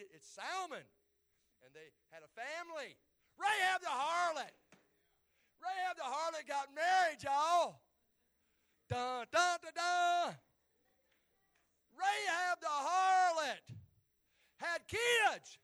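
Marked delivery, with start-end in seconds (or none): 3.4-7.8 high, loud proclamation; preaching shout. Real, not acted. Preacher announcing Rahab's marriage to congregation, triumphant shout. 8.9-10.4 loud, high mock fanfare; dramatic sting sound effect. Preacher hyping up the next reveal, loud dun-dun sting. 12.0-15.6 slow, high, very loud proclamation; preaching shout. Real, not acted. Preacher revealing Rahab had children, full-force shout.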